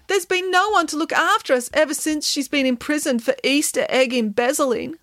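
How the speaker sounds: high, plaintive moan. A narrator's reading. Samantha telling of Nanny's imprisonment, plaintive moan. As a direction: moan it as a sorry tale. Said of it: "moaned Samantha".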